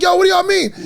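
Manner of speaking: very high mock-furious rant; screaming, cussing dad. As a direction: imitate a dad blowing up at everyone laughing. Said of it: "he is cussing and screaming".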